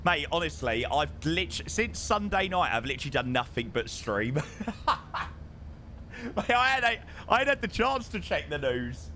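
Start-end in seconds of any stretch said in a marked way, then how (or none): 4.4-6.3 airy rising laugh, no voice; breathy laugh. Real, not acted. Streamer laughing at himself, a breathy laugh. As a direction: let a soft breathy laugh out.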